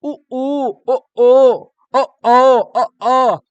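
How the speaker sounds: loud rounded उ and ओ; rounded vowel demo. Shown as a demonstration. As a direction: round the lips hard on ओ. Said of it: "गोलाकार बनेगा". (होंठ गोल करके ज़ोर से ओ)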